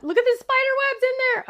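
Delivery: high, excited gushing; excited squeal. Real, not acted. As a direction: squeal with delight at it. Excited squeal over design.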